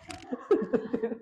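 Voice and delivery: a real soft laugh; soft chuckle; amused laugh. Real, not acted. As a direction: laugh quietly at the story. Laughs at the student story.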